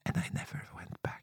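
breathy confessional whisper, barely audible. Real, not acted. Confessing to the host she never went back, whispered. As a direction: drop to a secret whisper for the punchline.

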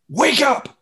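high, loud sharp order; abrupt shouted wake-up command, acted out; sudden command. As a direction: call her back with a burst. Acting his command to the dead.

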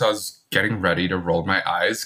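normal talking voice

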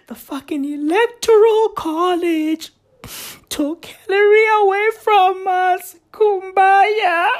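high wailing lament; mock crying liberal. Streamer mocking a liberal, high wailing lament. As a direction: whine it like a weeping liberal. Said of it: "You are all kumbaya".

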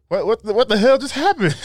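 high-pitched baffled outburst; stunned, climbing squeal of confusion; mock shock. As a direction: blurt it in rising disbelief. Quoting a shocked reaction.

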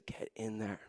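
urgent whisper, voice dropped right down; a quick hushed prompt from God; whispered nudge. Retold or imitated. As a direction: whisper it like a secret push to go. The Lord's nudge, retold.

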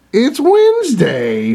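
high, slow delighted discovery; surprised realization. Retold or imitated. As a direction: draw it out, amazed. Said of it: "I was like".

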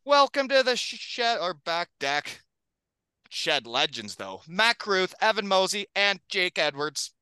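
big hype podcast announcer read; announcer intro. Acted. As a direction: introduce the guests like a showman. Host reads hype podcast intro.

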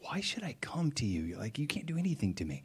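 whispered, unbothered dog; dog's unimpressed thoughts. Retold or imitated. Dog ignoring the boy's call, whispered and unimpressed. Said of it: "Obi's just looking like".

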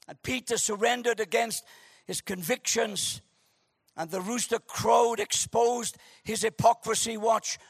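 ordinary talk, nothing marked

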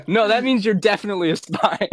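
mimicking the police's conclusion while cracking up; laughing mock reply. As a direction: laugh out the accusation.

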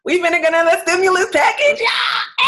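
high, loud excited yell; excited shout. Real, not acted. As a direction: shout it out with glee. Excited shout about stimulus.

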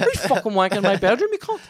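high, fast, laughing accusation; laughing mock fury at a roommate; laughing outrage. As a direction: accuse him while cracking up. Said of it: "I'm going".

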